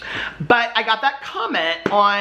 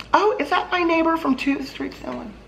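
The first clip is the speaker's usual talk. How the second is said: lightly surprised, pitched up high, as if spotting someone mid-thought; a sudden distracted "oh, who's that" aside; mock surprise; 11 semitones higher than usual. Mind wandering while reading.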